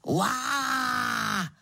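long drawn-out "waah" roar; mock leopard roar. A narrator's reading. Narrator imitating a terrible roar from outside, mock roar. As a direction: let out a drawn, steady beast roar. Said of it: "strašlivý řev".

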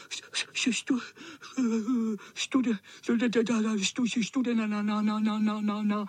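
high, stuttering shiver on each syllable; shivering tomcat stammer; teeth chattering. Acted. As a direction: chatter through it as if freezing. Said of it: "Drkotal zuby".

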